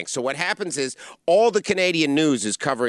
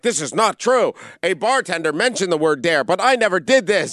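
loud, higher defensive denial; mock denial; 5 semitones higher, 5 dB louder than usual. Imitating Jeff Paul denying the dare, loud and indignant. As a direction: protest it loudly. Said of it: "Jeff Paul's like".

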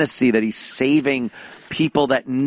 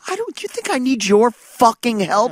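high, incredulous telling-off; mock indignant customer; 8 semitones higher than usual. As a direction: turn on him, offended. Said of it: "He should turn to that idiot and just go".

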